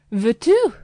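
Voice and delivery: loud, high scolding of the poodle; scolding cry. Acted. Landlady cuffing the poodle, loud and high scolding. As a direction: snap at the dog. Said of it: "she cried, with a slap".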